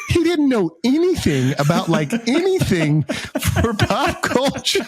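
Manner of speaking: high, slow, mock-teasing wife voice; imitating his wife. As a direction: tease him the way his wife would. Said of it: "She was like".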